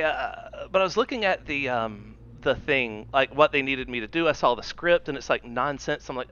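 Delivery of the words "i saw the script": ordinary delivery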